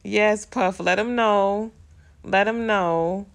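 excited cheering. Real, not acted. Cheering on screen couple.

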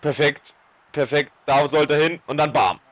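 excited commentary. Real, not acted. Replaying a goal excitedly.

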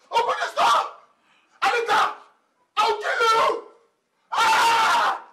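high, strained yell through a door; enraged shouting. Acted. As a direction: scream threats at the locked door. Husband rages at locked door.

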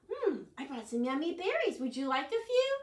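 friendly Fox. Acted. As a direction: offer the berries sweetly. Fox answers Bird.

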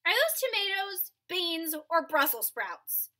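a nosy neighbor peering over the fence; curious neighbor. Acted. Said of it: "asked Wesley's neighbor".

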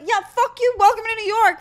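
high-pitched indignant New York yell; re-enacted line-cutting outburst; retold yelling. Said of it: "I yelled at him at the top of my lungs".

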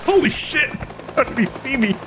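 high panicked shriek; panicking gamer. Real, not acted. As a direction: freak out, high and frantic. Spotted, panicking.

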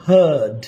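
হার্ড with a long, central "er" vowel; flattened throat. Shown as a demonstration. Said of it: "এটা কণ্ঠনালী চ্যাপ্টা যাবে".

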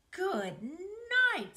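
high, loud disgusted scream; a boy screaming at a rotten-egg stink, high and loud. A narrator's reading. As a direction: yelp it as the stink hits you. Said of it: "screamed Avery".

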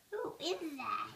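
ordinary talk

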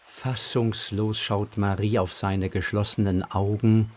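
ordinary talk, nothing marked

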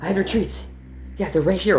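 high-pitched, careful pleading; coaxing a dog. Acted. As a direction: talk down an angry dog in a high voice. Coaxing an angry dog with treats.

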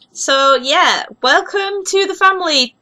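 usual speaking voice

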